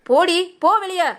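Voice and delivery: furious yell; angry shout. Acted. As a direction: drive her out the door. Mother-in-law chasing her out.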